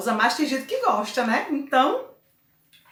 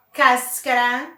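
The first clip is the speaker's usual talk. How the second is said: cáscara with a strong first syllable; stress on CÁS; 4 dB louder than usual. Shown as a demonstration. Teacher gives an example of esdrújula, stressing the start of cáscara. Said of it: "a primeira é cas".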